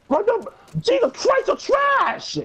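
high startled yell at a teammate; shouting. Real, not acted. Sudden ambush in game.

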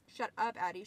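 ordinary delivery

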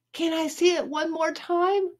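high, soft pleading; put-on girly begging; fawning girl. A narrator's reading. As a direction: coax sweetly in a high girlish voice. Judy begging to look.

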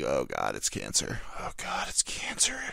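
hushed mock terror over symptoms; hammed-up whispered panic; mock dread. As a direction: whisper it like doom is certain.

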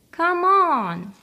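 high, loud come-on; eager call. A narrator's reading. Teddy calls Big Bear to the woods, high and loud. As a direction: tug him along eagerly.

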